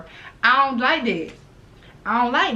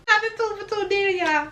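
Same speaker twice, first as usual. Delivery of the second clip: high squeal through laughter; can't hold the laugh, pitch flies up; squeaky laughing; 11 semitones higher than usual. Real, not acted. Repeats punchline while laughing.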